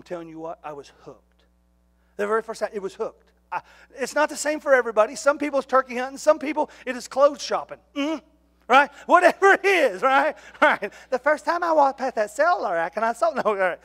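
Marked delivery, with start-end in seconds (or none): none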